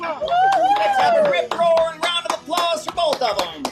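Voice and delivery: loud cowboy showman patter; kids party magician. Acted. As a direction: hype up the crowd like a stage magician. Old West magician show patter.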